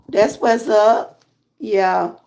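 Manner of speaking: ordinary talk, nothing marked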